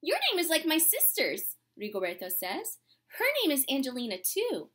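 gentle, unhurried storybook reading; reading to children. Acted. Teacher reading a storybook to her class, gentle and unhurried. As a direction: read it like a picture book to the class.

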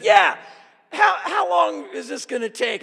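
high loud impatient demand; hammed-up exasperated shout. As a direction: bark out your impatience. Impatient retort to officers.

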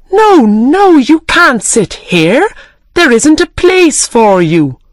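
high, loud shocked protest; crying out. Acted. Tea party hosts crying out to turn Alice away. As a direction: shoo her away from the table, loudly. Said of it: "they cried".

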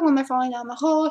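ordinary talk, nothing marked

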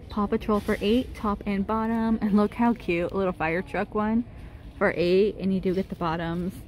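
ordinary talk, nothing marked

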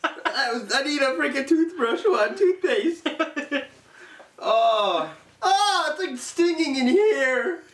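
high, slow disgusted complaint. Real, not acted. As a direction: whine about the sting. Mouth stinging after bean.

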